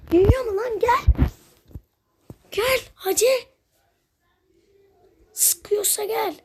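high-pitched, heated goading; worked-up trash talk, pitch jumping up; taunting challenge. Real, not acted. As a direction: dare them to come at you. Taunting enemy players to come fight.

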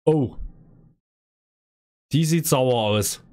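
ordinary talk

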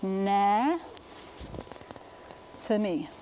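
Na stressed and held, then "to me" after a long pause; syllable by syllable. Shown as a demonstration. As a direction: sound out Na, to me in separate pieces. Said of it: "accent the next syllable".

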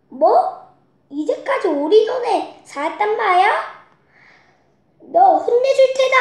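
high, loud rising threat; angry pig. Acted. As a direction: build into an angry shout.

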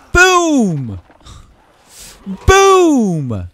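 big loud triumphant "Boom!"; gleeful shout. Real, not acted. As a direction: yell it out like landing a huge hit. Kicking zombies, shouting boom.

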